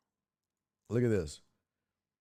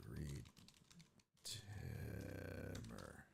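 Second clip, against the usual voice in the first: whisper; 7 semitones lower, 20 dB quieter, much slower than usual. Real, not acted. Name whispered after saying it.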